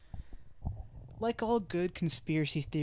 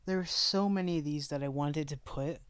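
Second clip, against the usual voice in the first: normal talking voice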